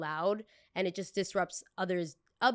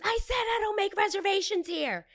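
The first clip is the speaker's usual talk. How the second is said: shrill mock outburst; 14 semitones higher, 6 dB louder than usual. Guest triggered, snapping.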